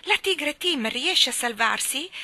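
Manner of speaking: high, loud urgent question; eager shout. A narrator's reading. Michael arriving after the others, shouting his question eagerly. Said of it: "gridò Michael".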